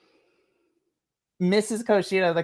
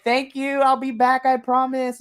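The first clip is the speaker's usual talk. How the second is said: excited; 8 semitones higher than usual. Acted. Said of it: "it's just like".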